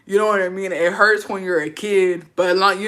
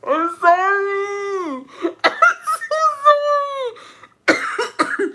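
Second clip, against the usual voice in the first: high, stretched-out squeal of apology; laughing apology; 6 dB louder, much slower than usual. Real, not acted. Host apologizing to letter writer, laughing too hard to speak. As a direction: wail it through helpless laughter. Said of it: "the reason I'm laughing".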